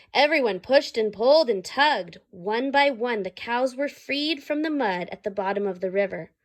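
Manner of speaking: usual speaking voice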